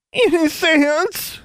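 high, drawn-out tearful wail; child wailing. A narrator's reading. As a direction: wail like a frightened little boy. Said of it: "he wailed".

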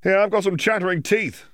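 high, cheerful call to the room. Acted. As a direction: call out over the crowd. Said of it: "someone called".

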